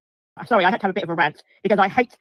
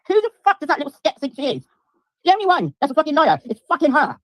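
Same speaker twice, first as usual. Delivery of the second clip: angry; 7 semitones higher, 3 dB louder than usual. Real, not acted. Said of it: "I'm fucking furious".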